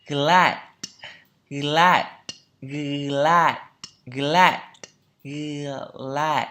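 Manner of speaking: glad said emphatically, vowel stretched; loud, deliberate model of glad; pronunciation drill. Shown as a demonstration. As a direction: punch out glad in isolation for learners. Teacher drills isolated word.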